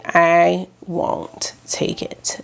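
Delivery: slow whispered aside. Real, not acted. Vlogger muttering to herself while swatching, whispered. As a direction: breathe it as a private aside. Aside under her breath.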